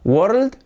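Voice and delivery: "world" with an open Spanish-style o, rising; mispronounced world. Shown as a demonstration. Teacher showing learners the mistake, mispronounced world. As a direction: say "world" the wrong way, tone going up. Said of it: "No. ¿Cómo se pronuncia esto?".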